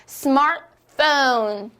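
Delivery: 스마트폰 slowly as "SMAR-fone", T dropped; English pronunciation drill. Shown as a demonstration. Teacher leads learners repeating aloud, English pronunciation. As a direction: model 스마트폰 slowly in two English beats, no T. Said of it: "소리 크게 내어볼게요".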